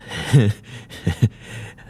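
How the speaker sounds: odd high giggle; a loose, drugged chuckle; dazed laugh. Acted. Man laughs before answering.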